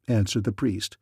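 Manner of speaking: usual speaking voice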